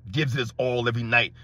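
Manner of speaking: low, dull mock quote of the claim; mocking fan imitation. Host parroting a LeBron fan's claim, low mocking imitation.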